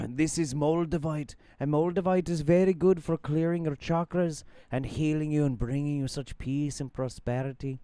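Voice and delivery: mock-serene sales patter in his accent; Mark Bayerski impression. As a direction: imitate his accent, selling crystals soothingly. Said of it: "I can't do his accent now".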